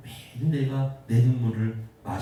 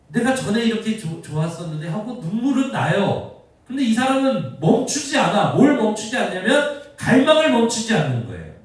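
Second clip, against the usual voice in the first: high, loud sermon delivery; raised voice pressing the point on the congregation; full-force preaching; 9 semitones higher, 6 dB louder than usual. Real, not acted. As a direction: preach with urgent, sustained intensity.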